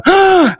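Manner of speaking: petit cri haut et ravi; lâche un ah de ravissement; cri de plaisir aigu. Real, not acted. Exclamation de plaisir au lavabo. (cri de joie aigu)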